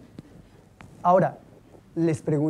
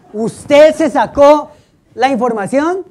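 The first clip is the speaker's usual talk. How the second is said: slow, much louder and higher accusatory shout; harsh interrogating tone; 7 semitones higher, 11 dB louder, slower than usual. Retold or imitated. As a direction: bark it at the suspect like a stern prosecutor. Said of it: "Pero no me grite".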